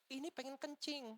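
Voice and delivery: very high-pitched mock yell; retold yell. Possessed woman demands the toilet, mock high-pitched yell. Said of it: "Langsung dia teriak".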